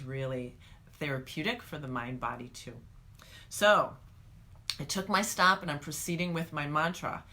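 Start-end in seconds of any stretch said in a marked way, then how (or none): none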